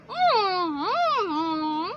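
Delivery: high, wavering closed-mouth moan; muffled moan. Acted. Jeffy answering the doctor with arms in mouth, muffled moan. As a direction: hum a long moan through a full mouth. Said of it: "Jeffy is gonna be like".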